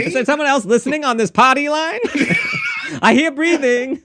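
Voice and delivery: high voice breaking into laughter; party-line gossip. Pretend. Host joining the party-line joke, high and giggling. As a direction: play a nosy old lady on the line.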